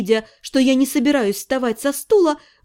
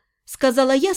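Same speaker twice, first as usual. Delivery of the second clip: ordinary delivery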